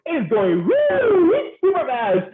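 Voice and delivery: excited woo. Acted. Describing the plane in flight, a high soaring woo. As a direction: let the woo soar up high and drawn out.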